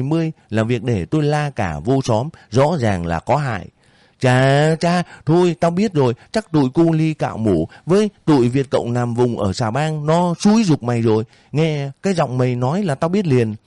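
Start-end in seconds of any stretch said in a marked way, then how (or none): 4.2-13.5 put-on higher, accusing old woman's voice; landlady sneering at the cowherd; suspicious landlady. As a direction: catch him out like a nasty landlady. Bảy Vàng accusing the boy.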